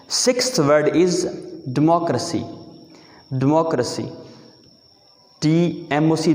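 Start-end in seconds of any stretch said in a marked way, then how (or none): 1.8-4.0 democracy as "di-MOK-ruh-si", stress on mok; correct pronunciation. Shown as a demonstration. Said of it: "इसको आप डेमोक्रेसी पढ़ोगे". (सही तलफ़्फ़ुज़, democracy यानी "डिमॉक्रसी")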